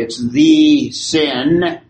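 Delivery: "the" drawn out and slowed, leaning on the article; slow right down and hit "the" before "sin"; stress on THE. Shown as a demonstration. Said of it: "there's definitely a definite article there".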